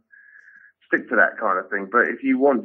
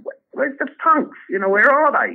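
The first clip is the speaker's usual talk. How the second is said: exasperated cry; 13 semitones higher, 3 dB louder than usual. Retold or imitated. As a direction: plead for the punks at a high pitch. Comedian voicing his own complaint.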